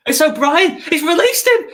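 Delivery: high, rising excitement; real mounting disbelief; excited build-up. Real, not acted. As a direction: voice climbing as the chance opens up. Live reaction as attack builds.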